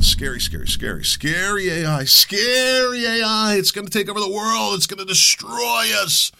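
mock spooky warning. Mocking AI fearmongers with a spooky voice. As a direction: ham up a scary bedtime story.

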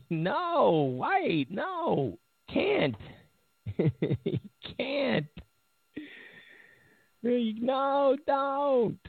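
high, whiny mock denial with a fake sob; hammed-up imitation of outraged critics wailing no; mock panicked protest. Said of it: "They're like".

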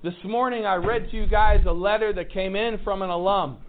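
ordinary talk, nothing marked

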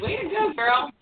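high, bright cheer on way to go; an excited shout of praise. Real, not acted. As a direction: cheer her on. Host cheers a teen's feat.

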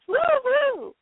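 high whooping cheer; excited cheer. Real, not acted. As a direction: whoop with glee. Cheering during evil dance.

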